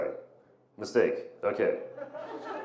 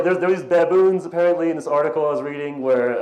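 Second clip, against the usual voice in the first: usual speaking voice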